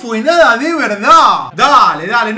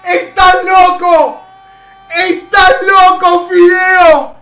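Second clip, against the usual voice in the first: screaming celebration; 6 semitones higher, 9 dB louder, slower than usual. Real, not acted. Celebrating goal, screaming at player.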